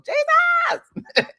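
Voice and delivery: high yelled call for Jesus. Retold or imitated. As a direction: blind man crying out to be heard. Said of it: "so he yelled out".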